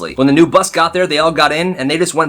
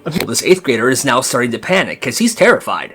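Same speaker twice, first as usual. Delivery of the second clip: usual speaking voice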